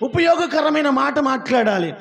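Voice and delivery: preaching. Acted. Pastor urging the congregation, emphatic preaching. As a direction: drive home each point from the pulpit.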